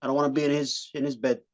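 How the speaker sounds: lightly voiced De Niro-style horse tough guy; a hint of a mob horse threatening you. Mock De Niro horse warning a man he's a dead man. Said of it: "my best impression of De Niro as a horse".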